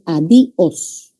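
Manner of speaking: adiós broken as a-DI-os, stress on the i; separated vowels. Shown as a demonstration. Teacher showing what separating would sound like, a-DI-os. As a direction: split adiós into three, hitting DI. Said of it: "si separáramos".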